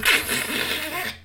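high squealing giggle; high laugh. A narrator's reading. Narrator cackles at freeze.

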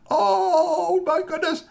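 very high, loud "oh my goodness"; mock dismay. Boss imagined reacting to bad news, high gasp. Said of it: "You'd think he was like".

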